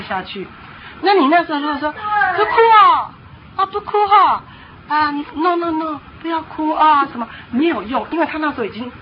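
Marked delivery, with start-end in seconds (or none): 2.4-7.1 put-on high-pitched, sing-song hushing of a child; ineffectual coaxing. Parent vainly soothing a child in a tantrum, high coaxing. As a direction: coax a crying child, pleading rather than commanding. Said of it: "我们平常对孩子讲话柔".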